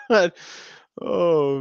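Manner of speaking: ordinary talk, nothing marked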